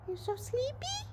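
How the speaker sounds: high, soft cooing question. Real, not acted. Owner cooing to her drowsy horse, high and soft. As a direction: coo gently way up high.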